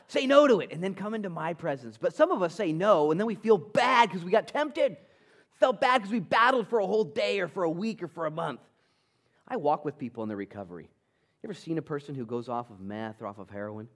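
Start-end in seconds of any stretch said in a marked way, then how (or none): 0.1-8.6 high, loud, driving sermon voice; fired-up pulpit delivery; full-force preaching. Real, not acted.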